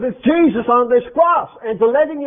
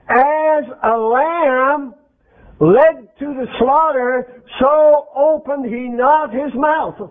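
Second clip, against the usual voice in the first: very slow, set apart from the talk; solemn scripture reading; 3 semitones higher, slower than usual. Acted. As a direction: intone it like a reading from the Bible. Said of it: "What does it say about him?".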